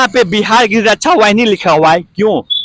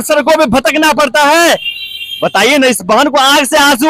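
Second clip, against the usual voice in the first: raised, pleading reporter's plea; impassioned appeal; 5 semitones higher than usual. Real, not acted. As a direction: press the ministers with rising indignation. (ऊँची आवाज़ में भावुक appeal)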